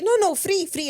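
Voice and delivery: high, loud mock sales pestering; imitated insistent seller. Rose seller pressing a tourist, imitated high insistence. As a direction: push it on them, pitched right up.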